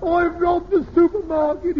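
high, loud imitation of a cry in sleep; mock sleep-talker cry. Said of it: "he cried out".

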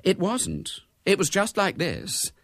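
put-on high, girlish matter-of-factness; placid, high-pitched reply; calm contradiction. A narrator's reading. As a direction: correct mother unbothered. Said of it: "said Bella calmly".

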